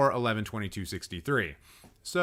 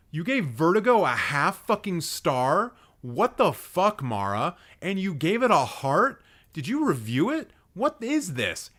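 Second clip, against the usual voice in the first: raised pitch, incredulous questioning; outraged disbelief; 6 semitones higher, 3 dB louder than usual. Real, not acted. Streamer confronting friend over Vertigo rating, incredulous outrage. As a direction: demand answers in high-pitched outrage.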